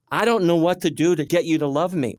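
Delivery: high, frustrated plea. Retold or imitated. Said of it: "we were angry and frustrated inside".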